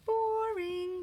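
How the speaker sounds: high, drawn-out sing-song; mock a sigh of boredom; high teasing sing-song boring. Self-mocking comment on her painting.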